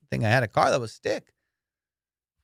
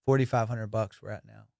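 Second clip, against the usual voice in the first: ordinary talk, nothing marked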